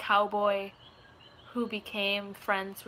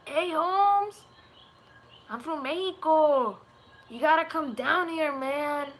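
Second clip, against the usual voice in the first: higher, louder Americanized Mexican accent; 6 semitones higher, 5 dB louder, slower than usual. Shown as a demonstration. Streamer demonstrates accents for chat, in a raised Americanized Mexican accent. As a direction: put on an easy, exaggerated Mexican-American accent. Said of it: "there's the Mexican".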